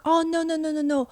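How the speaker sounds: normal talking voice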